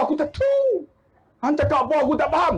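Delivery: high, flustered mock protest; comic exasperation, voice leaping up; baffled protest. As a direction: imitate a villager at a loss. Baffled villager protests again.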